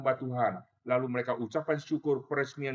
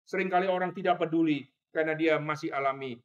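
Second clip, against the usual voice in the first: ordinary talk, nothing marked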